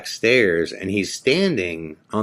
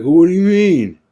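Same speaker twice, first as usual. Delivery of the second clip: high, loud indignant outburst; mock drunk taking offence; 10 semitones higher, 8 dB louder than usual. Drunk owner confronting the narrator, indignant shout. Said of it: "And he's like".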